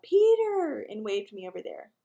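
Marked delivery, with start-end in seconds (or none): 0.0-0.8 high, loud call across a crowd. A narrator's reading. Joanne calls Peter over from the ticket line, calling out. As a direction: call the name out to catch someone's attention. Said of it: "because she called".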